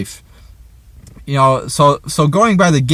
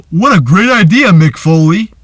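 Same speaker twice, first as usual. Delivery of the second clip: higher, louder put-on sarcastic voice; mock Stephanie; 5 semitones higher, 7 dB louder than usual. Reviewer mocking Stephanie sneering at Foley. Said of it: "She goes".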